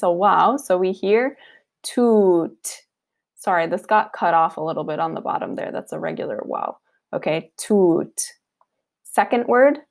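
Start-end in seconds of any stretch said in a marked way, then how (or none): 1.8-2.8 "toot" with a long held u; long waw. Shown as a demonstration. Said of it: "So this is just long". 7.6-8.3 "toot" slowly, long u held; slow long waw. Shown as a demonstration. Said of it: "So this is just long".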